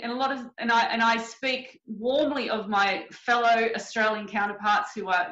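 ordinary delivery

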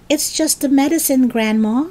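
George reassuring. A narrator's reading. George to burning Grandma about medicine, reassuring.

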